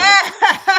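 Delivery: loud amused laugh. Real, not acted. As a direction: burst of real laughter. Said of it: "why does he sound like that".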